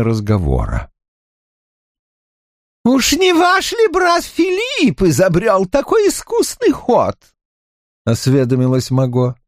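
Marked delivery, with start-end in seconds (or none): 2.8-7.2 countess voice. Acted. Countess Mahaut asks.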